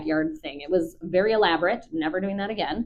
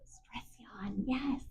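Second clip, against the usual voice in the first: soft whispered aside; whispering; 4 semitones higher, 15 dB quieter, much slower than usual. Real, not acted. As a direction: whisper so the dog stays calm.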